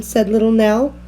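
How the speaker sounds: usual speaking voice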